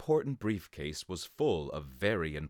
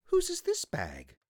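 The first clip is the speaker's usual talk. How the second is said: much higher, puzzled teacher voice; 3 dB louder than usual. Acted. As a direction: ask the class like a bright teacher. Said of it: "said the teacher".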